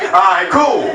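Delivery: normal talking voice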